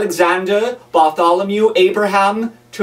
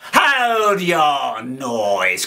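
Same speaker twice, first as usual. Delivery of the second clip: slow, drawn-out menacing shout; terrible convict shout; much slower than usual. A narrator's reading. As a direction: bellow it slowly at the boy. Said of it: "cried a terrible voice".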